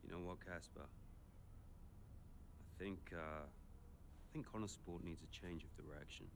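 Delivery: low, soft, measured resolve; quietly cold. Acted. Boss starting to fire Casper after a row, quietly cold. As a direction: pull back into icy calm.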